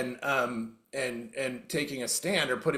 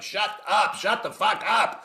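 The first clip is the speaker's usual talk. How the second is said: higher mock wife voice, fed up; wife telling him off; 4 semitones higher, slower than usual. Wife snapping at him to stop talking, mock fed-up. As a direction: play the exasperated wife, pitched up. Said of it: "Even my wife was like".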